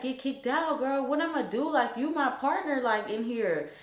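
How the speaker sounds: high-pitched retold dismay; alarmed friend. Friend fearing to lose her partner, alarmed.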